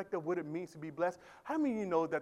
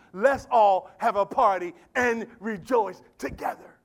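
loud, high, rousing sermon delivery; shouted, fervent preaching; full-force preaching; 9 semitones higher, 18 dB louder than usual. Acted. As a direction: preach it out to the whole church.

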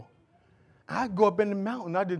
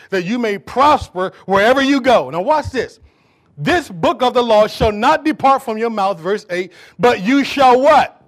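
loud, raised scripture reading; full-force preaching; 3 semitones higher, 13 dB louder, slower than usual. Acted. Preacher calling the congregation to say it again, full-force preaching. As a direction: proclaim the verse and call for a response.

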